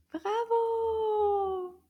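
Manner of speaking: high cheering bravo; delighted kids' show praise; excited praise. Acted. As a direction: cheer the little one on. Praising child's answer.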